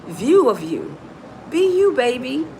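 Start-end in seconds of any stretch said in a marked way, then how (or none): none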